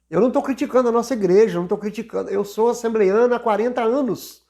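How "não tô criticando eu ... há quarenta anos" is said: ordinary talk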